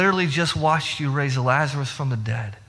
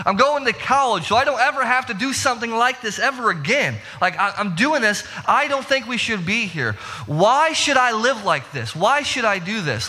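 whiny complaining; 7 semitones higher than usual. Retold or imitated. Preacher voicing his own college complaints.

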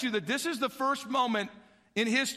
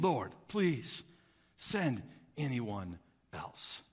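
low, slow, sinking plea; subdued, drawn-out entreaty; quiet pleading; 11 semitones lower, 6 dB quieter, much slower than usual. Acted. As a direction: beg softly and heavily, dropping away. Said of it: "Moses pleaded with the Lord".

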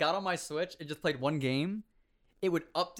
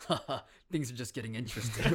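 cocky anime rival chuckling the line; anime rival laugh; 4 semitones lower, faster than usual. Retold or imitated. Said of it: "anime meme where, the guy... he's like".

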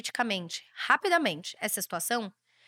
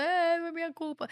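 mock whining; 6 semitones higher than usual. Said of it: "ficar choramingando no canto".

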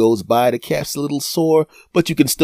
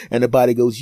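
ordinary talk, nothing marked

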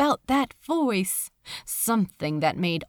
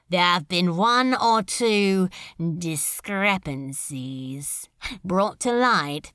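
Beelzebub, smooth menace; 2 semitones lower than usual. Acted. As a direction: purr the praise like a prince of hell. Beelzebub voiced in the story.

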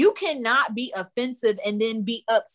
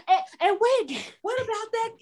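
mock Karen laughing; 12 semitones higher than usual. Imitating Karen's awkward question.

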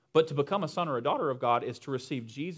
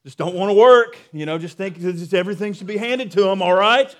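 loud, raised-pitch mock old-timer rant; grumbling senior; 4 semitones higher, 9 dB louder than usual. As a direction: complain like an elder looking down on the kids. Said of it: "the senior saints will just, like, look down".

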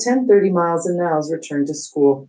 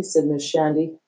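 ordinary delivery

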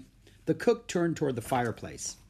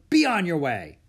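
louder, higher brusque order; snorting dismissal; 5 semitones higher, 6 dB louder than usual. A narrator's reading. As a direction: send them packing. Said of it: "snorted the butler".

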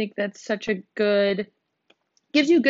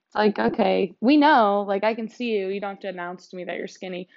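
ordinary delivery

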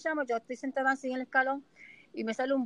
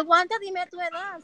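higher, louder imitation of an insistent doctor; quoted urgent demand, pitched up; 7 semitones higher, 6 dB louder than usual. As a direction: press the patient for an answer, voice raised. Doctor insisting on her age, retold.

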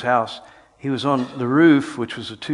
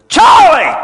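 huge shouted call of his name; piercing scream of a name; 15 dB louder than usual. Retold or imitated. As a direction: yell it right in his face. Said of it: "and I screamed at him".

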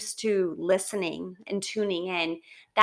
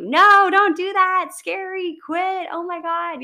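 much higher and louder, frantic alarm; panicked ego screaming; 10 semitones higher, 8 dB louder than usual. Retold or imitated. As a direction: shriek it like an inner voice in panic. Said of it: "Your ego is screaming at you".

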